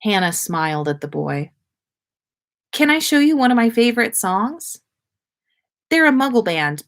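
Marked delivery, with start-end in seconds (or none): 2.7-6.8 put-on eager girl. A narrator's reading. Hannah's voice.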